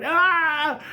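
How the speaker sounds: high, loud "Ah!"; startled storybook scream; frightened scream. Acted. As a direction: shriek and dive for cover. Fergie hides in fright.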